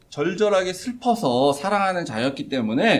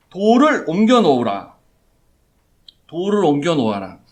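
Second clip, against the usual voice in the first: commanding, starting louder and easing slightly on the repeat; firm command; 5 dB louder than usual. Acted. Jesus ordering the tomb stone moved, firm command. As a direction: give a deliberate order, pausing before repeating it. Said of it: "명령을 내리십니다".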